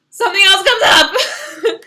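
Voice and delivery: high, loud, slow squeal through a laugh; squealed pop-up. Pretend. Reading the whack-a-mole card, squealing as the next thing pops up. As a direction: let the last one pop up with a shriek.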